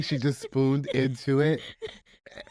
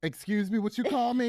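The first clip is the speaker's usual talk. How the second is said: higher-pitched playful indignation; mock offence; 7 semitones higher than usual. Host joking that the pie's name is an insult, mock offence. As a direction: pretend to be affronted, pitched up.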